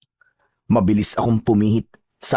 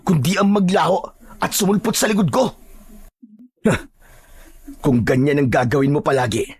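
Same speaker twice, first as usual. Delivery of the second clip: raised-pitch, defiant challenge; angry taunt; 7 semitones higher than usual. Acted. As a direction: throw scorn at the wounded enemy. Hero taunts the wounded enemy. (galit at mapanghamong panunuya)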